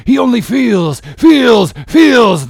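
high, loud, insistent repeats; impassioned outburst. Acted. Captain declaring that he never thinks, impassioned outburst. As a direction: burst out, driving FEELS harder each time.

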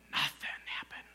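a hushed dramatic whisper. Acted. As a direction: drop to a breathy whisper for effect. Dramatic pause about the silence.

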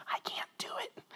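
silent mouthed whisper; mouthed words. Retold or imitated. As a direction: barely breathe it, no voice. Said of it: "he mouths".

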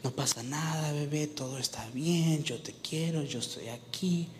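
soft, tender reassurance; soothing mother. Acted. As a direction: comfort a crying little child. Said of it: "su mamá lo acariciaba".

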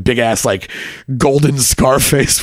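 normal talking voice

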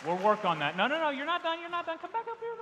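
high, loud mock drill call; playful coach shout. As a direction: yell him back up playfully. Volunteer stops after push-ups.

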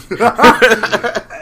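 high burst of laughter; loud laugh. Real, not acted. Laughing at the joke.

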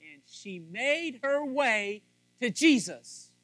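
loud, high, building sermon; preaching. Real, not acted. Pastor preaching to husbands at full force. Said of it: "I'm getting a little preachy there".

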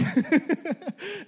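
high amused laugh; quick giggle after the punchline. Real, not acted. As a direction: laugh at your own story. Laugh after patience story.

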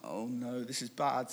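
low, hushed mock dismay; retold inner groan, very soft and low; sinking dread. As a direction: mutter it to yourself as you realise you're caught. Retold sinking realisation when caught.

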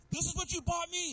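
put-on high, indignant teen voice; mock indignant son. Son outraged at his father's gift, high-pitched mock indignation. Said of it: "He's all mad".

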